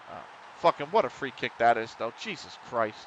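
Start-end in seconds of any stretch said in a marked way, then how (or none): none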